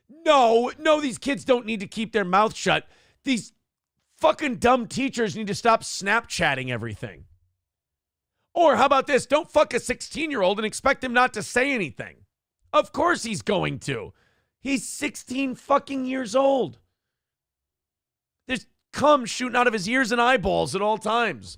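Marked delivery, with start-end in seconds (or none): 0.1-2.9 emphatic no. Real, not acted. Rebutting a chat comment. 8.5-21.5 heated rant. Real, not acted. Ranting at the teacher.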